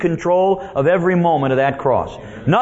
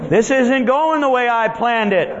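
high-pitched mock dismay; dismayed devil; 9 semitones higher than usual. Devil watching the crucifixion go wrong, mock dismay. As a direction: complain in a high voice, plan unravelling. Devil acted seeing his plan fail.

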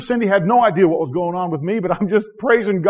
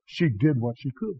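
gentle quote; 4 semitones lower, 8 dB quieter than usual. Retold or imitated. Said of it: "And Jesus said".

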